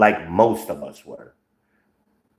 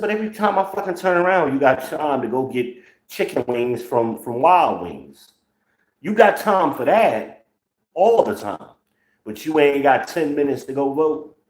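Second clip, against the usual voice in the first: higher, rising exasperated scolding; exasperated retort; 6 semitones higher than usual. Retold or imitated. Telling off a friend who won't vote, exasperated and higher. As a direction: throw the question back at him in disbelief. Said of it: "I said".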